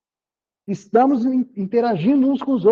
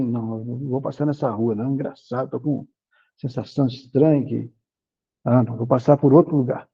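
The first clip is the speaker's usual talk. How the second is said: uneasy inner hunch; 8 semitones lower than usual. Acted. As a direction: talk yourself out of it, low and hesitant. Inner hunch voiced, avoiding a street. (pressentimento hesitante, em voz baixa)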